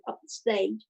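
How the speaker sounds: ordinary talk, nothing marked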